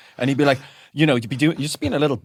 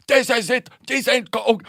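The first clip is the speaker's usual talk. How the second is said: mock angry dad; 11 semitones higher than usual. Dad yelling in car.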